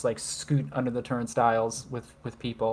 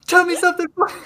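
very high, louder squeaky demand; silly voice; 9 dB louder, much faster than usual. Acted. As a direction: squeak it like a cartoon character. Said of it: "anytime I do a voice".

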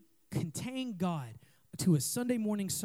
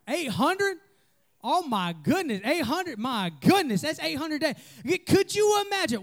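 high, loud gasping amazement at eight hundred; amazed disbelief; 8 semitones higher, 3 dB louder than usual. Real, not acted. Preacher reacting to a youth's streak, astonished and loud. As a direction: marvel at the number, pitch leaping up.

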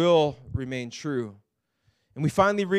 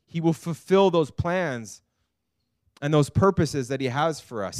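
ordinary delivery

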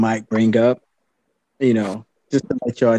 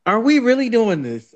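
incredulous; 9 semitones higher than usual. Real, not acted. Incredulous at topic.

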